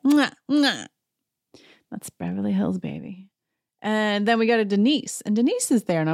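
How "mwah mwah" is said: mock air kisses. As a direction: blow two smacking mock kisses. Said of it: "And they're like".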